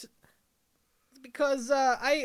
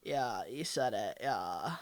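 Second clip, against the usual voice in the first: put-on lower, bored gossiping girl; low valley girl voice; 8 semitones lower than usual. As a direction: dish about her, bored. Girls gossiping about neighbor.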